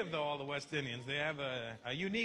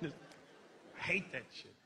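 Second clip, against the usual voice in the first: ordinary delivery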